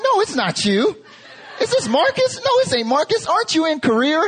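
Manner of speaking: very high, frantic Southern grandmother imitation; freaking-out Southern grandma. Preacher imitating grandmother doubting his call, freaking out in Southern accent. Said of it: "she starts freaking out".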